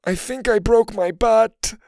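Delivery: high, drawn-out whimper; whined. A narrator's reading. Hurt friend answering in the dark, whining. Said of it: "Mac whined".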